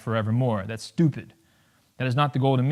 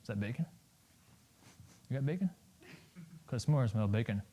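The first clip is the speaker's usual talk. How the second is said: sniffing police dog; 4 semitones lower, 14 dB quieter than usual. Retold or imitated. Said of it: "A police dog is like".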